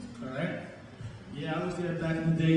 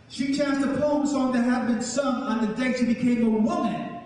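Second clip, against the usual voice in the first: poem recital; 3 semitones higher, 4 dB louder than usual. Acted. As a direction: chant the story of the chained queen with mounting grief. Performs poem from memory.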